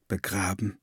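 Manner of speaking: low, soft numb disbelief; stunned quiet echo. A narrator's reading. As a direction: barely voice the word Begraben. Fox echoes in disbelief.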